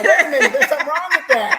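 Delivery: high, loud, laughing protest; mock outrage laughing. Real, not acted. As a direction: fake offence while cracking up.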